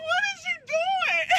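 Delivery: high squeal. Retold or imitated. Woman being cuffed, high squeal at officer. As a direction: squeal it in disbelief.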